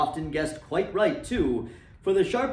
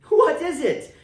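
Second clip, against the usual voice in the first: higher, louder eager question; eager cry; 12 semitones higher, 7 dB louder than usual. A narrator's reading. Fred crying out to his sister for the answer, eager. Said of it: "cried Fred".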